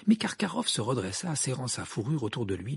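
ordinary delivery

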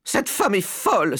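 very high indignant wife; scandalised high-voiced outburst; outraged exclamation. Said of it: "s'écria madame Jenkins".

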